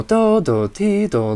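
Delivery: usual speaking voice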